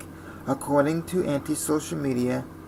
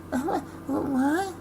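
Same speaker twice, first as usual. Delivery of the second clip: playing dumb contestant; 13 semitones higher, slower than usual. Mocking contestant asked about pay.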